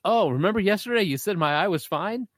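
put-on high, smug told-you-so kid. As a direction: gloat at the teacher.

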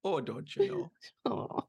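slow, high mock Midwest "don't you know"; Midwest accent. Host echoing the guest in a hammed-up Midwest voice. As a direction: ham up the Midwest twang. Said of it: "Midwest accent".

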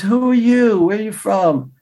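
imitated light Italian lilt; light Italian accent. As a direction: ask with a touch of Italian accent. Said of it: "He's got this light Italian accent".

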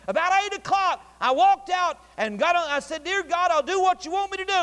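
loud, high revival-preacher delivery; full-force preaching. Acted. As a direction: preach the testimony out, high and driving.

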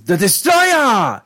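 "Destroyer" yelled as "Des-to-ro-YAH"; hammed-up monster-name cry on "Destroyer", final syllable soaring; Japanese-style pronunciation. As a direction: shout "Destroyer" with a long high "-yah" ending. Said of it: "that's how they say it in Japanese".

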